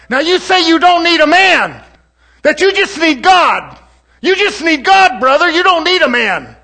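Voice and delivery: high, loud, shouting sermon delivery; preaching at full force. Acted. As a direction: preach it out to the whole congregation. Preacher rises again.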